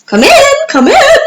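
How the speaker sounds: high, loud put-on granny voice; wolf faking a sweet old lady; sly wolf pretending to be granny.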